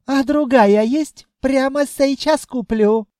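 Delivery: high, insistent djinn voice; eager djinn haggler. A narrator's reading. Djinn offers to buy another one, eager. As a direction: press for a deal, pitched high.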